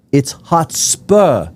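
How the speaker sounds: high, drawn-out English correction, final r dropped; offended English accent. Retold or imitated. Drunk fan correcting an American visitor, offended English accent. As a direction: imitate an indignant English fan, slow and high. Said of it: "he got, like, offended at me".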